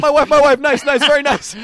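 high, loud Borat voice; Borat impression. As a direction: shout Borat's lines gleefully. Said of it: "you ain't Borat".

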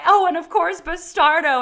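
high, loud, cheerfully oblivious sister; imitated blithe boast. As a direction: toss it out with mock pride.

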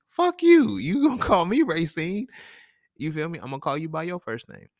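high, loud acted defiance; defiant outburst. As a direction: fire back high-pitched, starting loud. Retold defiance toward bosses.

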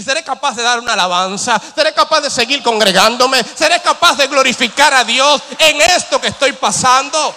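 building from strong to full loud declaration; a fervent believer vowing praise, building to a loud shout; rising preaching shout. Acted. Preacher voicing a faithful declaration.

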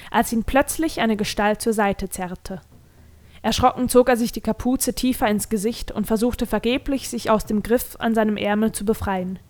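plain reading voice

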